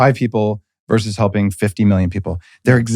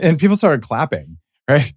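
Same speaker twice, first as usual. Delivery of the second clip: ordinary talk, nothing marked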